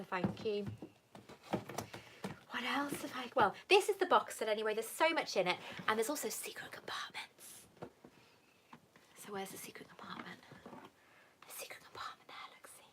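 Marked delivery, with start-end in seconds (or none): 5.9-12.9 hushed, conspiratorial whisper; secretive whisper. Pretend. Showing hidden secret compartments.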